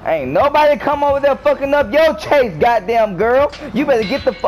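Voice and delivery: high, agitated rant; agitated scolding. Real, not acted. Scolding during the chase.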